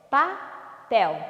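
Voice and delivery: PAPEL split into pa, pel with a clear pause; syllable by syllable. Shown as a demonstration. Teacher has pupils count syllables, "Papel" split pa-pel. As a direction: say "Papel" in two separate beats. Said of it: "Quantas sílabas?".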